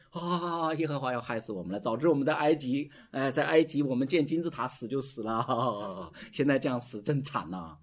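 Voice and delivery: hammed-up wailing complaint; mock weeping. Israelites wailing after the spies' report, exaggerated mock crying. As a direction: bawl theatrically, drawing out the ends. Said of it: "哭得好厉害啊".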